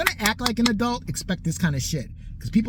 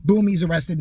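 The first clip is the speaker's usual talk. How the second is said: normal talking voice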